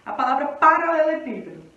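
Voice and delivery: ordinary talk, nothing marked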